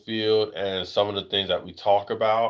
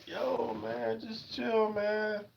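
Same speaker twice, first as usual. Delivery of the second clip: high and much softer, calming; mock hushed plea; 12 semitones higher, 12 dB quieter, much slower than usual. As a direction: ask them to tone it down quietly. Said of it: "it's like".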